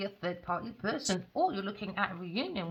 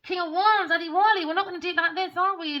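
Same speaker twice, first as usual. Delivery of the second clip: much higher and louder telling-off; loud scolding; 10 semitones higher, 8 dB louder than usual. Real, not acted. Reader scolding Adewale for leaving like this, high and loud.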